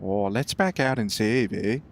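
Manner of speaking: Canadian accent slipping into cartoon Canadian; a hint of South Park Canadian; Canadian accent, second try. Shown as a demonstration. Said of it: "It went a bit, like, weird there".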